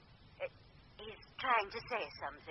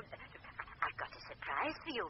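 slow, unvoiced teasing whisper; secretive whisper; 7 dB quieter, slower than usual. Medium teases the caller.

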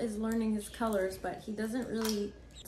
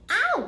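loud high yelp; sharp yelp of pain; 13 semitones higher, 10 dB louder than usual. Real, not acted. Owner yelping as the parrot bites, sharp real yelp. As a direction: cry out when bitten.

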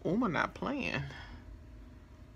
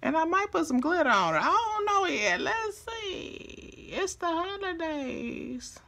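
much higher, sing-song teasing; high playful voice; 12 semitones higher, 3 dB louder than usual. Pretend.

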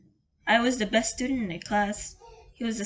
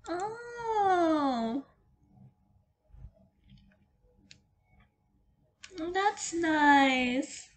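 touched; 7 semitones higher, much slower than usual. Real, not acted. Streamer moved by the heartwarming true end, tender. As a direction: let the ending get to you.

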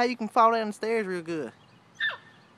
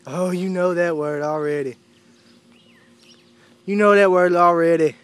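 loud praise; 3 semitones lower, 7 dB louder than usual. Real, not acted. Praising dog.

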